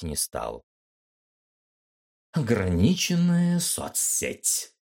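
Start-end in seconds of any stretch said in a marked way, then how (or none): 2.3-4.7 slow, high purring femme fatale. Acted. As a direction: purr it out like a sultry cat. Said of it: "промурлыкала она". (мурлыкающим голосом)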